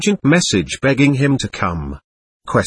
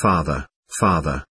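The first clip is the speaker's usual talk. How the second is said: tearful; 8 semitones lower, 5 dB quieter than usual. A narrator's reading. Dying boy calls his father.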